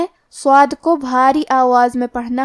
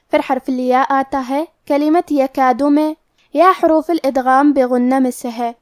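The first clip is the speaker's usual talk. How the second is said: ordinary delivery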